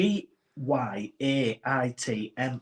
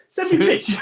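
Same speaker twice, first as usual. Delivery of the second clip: high, loud and eager; eager request; 14 semitones higher, 7 dB louder than usual. Retold or imitated. Friend imagining texting for a barbecue photo, eager. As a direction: ask for it like you can't wait. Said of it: "so close to message you going".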